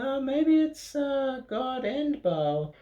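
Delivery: high, hesitant, dragging the end slowly; a puzzled villager guessing aloud; high confused villager. Acted. Narrator voicing a puzzled villager in the crowd, high hesitant voice.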